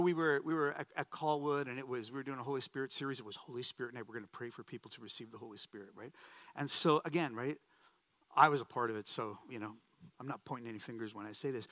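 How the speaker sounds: ordinary talk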